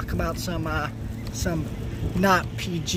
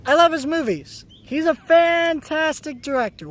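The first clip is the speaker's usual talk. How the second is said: enthusiastic; 10 semitones higher, 5 dB louder than usual. Real, not acted. Enthusiastic praise.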